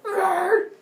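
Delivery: high, loud wordless growl of outrage; indignant outburst. Acted. Arachne reacts to being asked about the gods, acted outrage. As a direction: let out a short offended screech.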